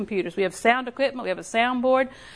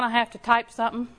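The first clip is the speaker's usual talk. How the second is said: ordinary talk, nothing marked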